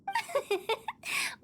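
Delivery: high, breathy little giggle; teasing high-pitched titter; playful giggle. Acted. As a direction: giggle mischievously at your own dark joke. Hu Tao giggles at her joke.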